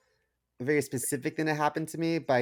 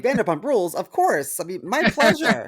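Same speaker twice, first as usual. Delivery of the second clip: high, loud gushing voice; delighted stranger; 9 semitones higher, 7 dB louder than usual. Retold or imitated. Co-host acts the stranger gushing about the show, high and loud. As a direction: gush like a fan hearing his show. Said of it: "And he was like".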